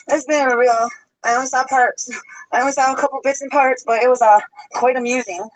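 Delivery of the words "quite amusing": normal talking voice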